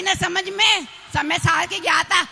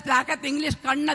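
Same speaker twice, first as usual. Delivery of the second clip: ordinary talk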